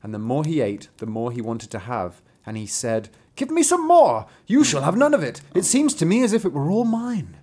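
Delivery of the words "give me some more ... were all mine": much higher and louder greedy demand. Acted. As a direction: grab the food like it's all yours. Father greedily demanding food.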